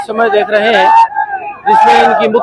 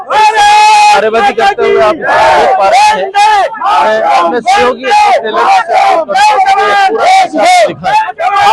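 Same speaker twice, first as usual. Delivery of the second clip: raised voice over crowd noise; shouting over crowd; 10 semitones higher than usual. Real, not acted. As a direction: call it out over the chanting. Reporting over chanting crowd.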